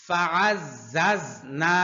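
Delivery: ফাআজ্জাজনা drawn out slowly with a hard ঝা; slow recitation model. Shown as a demonstration. Teacher models the word for the class, slow. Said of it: "teacher models recitation".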